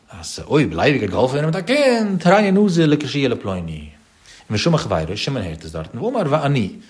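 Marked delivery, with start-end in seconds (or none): 0.5-3.9 excited call. Acted. Reuven excitedly calls to Levi about the child, loud and high. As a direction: voice climbs high and loud on the last words.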